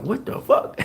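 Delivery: high, hushed, replayed astonishment; stunned disbelief. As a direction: act out shock under your breath, pitched high. Acting out his stunned reaction.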